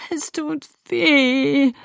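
high, drawn-out whimper of pain. A narrator's reading. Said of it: "wimmerte ich". (wimmernd, hoch und langgezogen)

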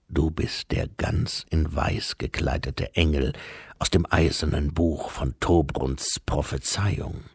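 patient, ponderous bearer of bad news; solemn Death. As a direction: speak gravely and deep, with breathy line endings. Voices Death in the story.

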